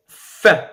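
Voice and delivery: "fin" with nasal vowel and silent n. Shown as a demonstration. Teacher illustrating nasal sounds, "fin" without pronouncing the n. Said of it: "il n'est pas prononcé".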